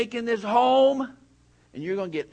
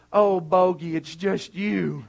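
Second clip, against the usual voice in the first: slow, lightly voiced relief to the dog; a hint of the sister sighing it's only the dog; relieved sister; 2 semitones lower, 3 dB louder, slower than usual.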